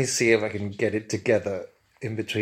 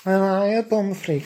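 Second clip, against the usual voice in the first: higher, louder camp Scouse hello; camp Liverpool accent; 9 semitones higher, 6 dB louder than usual. Acted. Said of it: "in his camp Liverpool accent".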